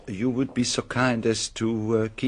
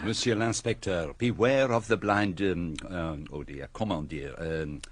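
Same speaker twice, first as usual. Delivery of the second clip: put-on French accent; 3 semitones lower than usual. Poirot searching for English idiom.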